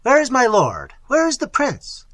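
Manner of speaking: loud, high-pitched calls; calling out. A narrator's reading. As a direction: call through the vaults for your master. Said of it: "calling out".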